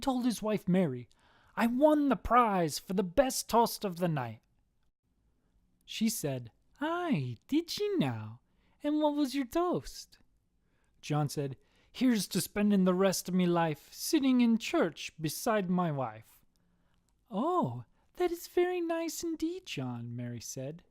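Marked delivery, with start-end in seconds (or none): 1.5-4.4 higher, lilting Irish boast. Acted. Husband tells wife of prize, proud Irish voice. 6.8-10.2 high-pitched lilting wife; Irish wife. Acted. Wife asks husband about toast, high Irish voice. 11.9-16.3 higher Irish lilt. Acted. Husband gives wife a tamer toast, Irish lilt. 17.3-20.1 very high, lilting approval; pleased Irish wife. Acted. Wife praises husband's toast, high Irish voice.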